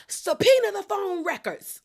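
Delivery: high, hammed-up shriek of a demand; mock scream. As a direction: shriek it like a courtroom order. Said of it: "Rula screams out".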